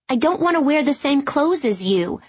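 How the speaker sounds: put-on child pushing back; lightly voiced girl protest. A narrator's reading. Child Ruby objects.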